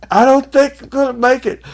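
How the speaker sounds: high, loud, faltering moan; high dying whimper. Retold or imitated. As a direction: moan like you're about to pass out. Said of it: "He's like".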